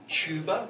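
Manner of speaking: "tuba" said "chooba"; Australian pronunciation. Shown as a demonstration. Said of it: "We say tuba".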